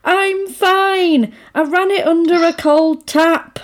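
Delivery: high, protesting man insisting he's okay; mock-stubborn man, pitched well up; imitating a typical man. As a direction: play a man brushing off help. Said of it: "This is such a man thing".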